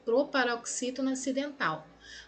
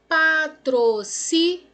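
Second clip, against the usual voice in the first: "Patrocínio" spelled out slowly, syllable by syllable; 5 semitones higher, 6 dB louder, slower than usual. Shown as a demonstration. Teacher slowly splitting "Patrocínio" into syllables for the class. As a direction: break "Patrocínio" into slow, separate syllables. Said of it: "Pá, pausadamente".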